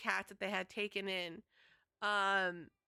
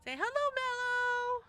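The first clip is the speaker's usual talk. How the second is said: very high, loud sing-song; 14 semitones higher, 5 dB louder than usual. Real, not acted. Streamer urging her cat to greet chat, very high and loud. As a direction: call to the cat in a bright squeaky voice.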